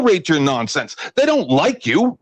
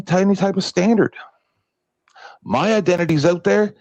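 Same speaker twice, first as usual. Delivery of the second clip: ordinary delivery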